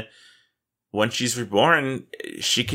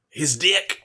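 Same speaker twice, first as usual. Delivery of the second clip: excited exclamation; 5 semitones higher than usual. Real, not acted. Punchline exclamation.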